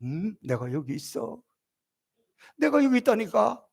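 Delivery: God's soft reassurance. Acted. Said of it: "하나님도 우리 가운데 오셔서".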